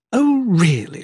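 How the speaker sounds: high, loud sarcastic oh; mock surprise. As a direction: throw the taunt back at him. Wife mocks husband mid-duel.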